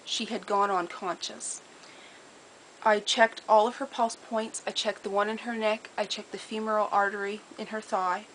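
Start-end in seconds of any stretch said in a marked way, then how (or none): none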